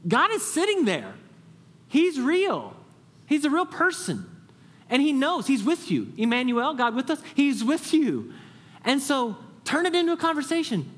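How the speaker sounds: high, loud, fervent preaching; preaching at full force. Acted. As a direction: lift it up like a revival preacher.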